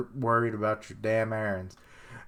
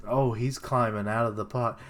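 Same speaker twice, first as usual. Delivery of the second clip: ordinary talk, nothing marked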